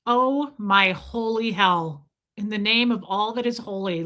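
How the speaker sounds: ordinary talk, nothing marked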